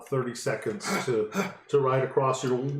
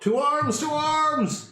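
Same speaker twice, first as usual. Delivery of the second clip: high, drawn-out alarm call; 4 dB louder, slower than usual. Acted. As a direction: cry the alarm across a city. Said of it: "calling out".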